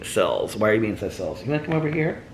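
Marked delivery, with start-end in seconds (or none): 1.4-2.2 high, soft coaxing voice; soft high pet talk; inviting a pet over. Real, not acted. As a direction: call the pet over gently, pitched high.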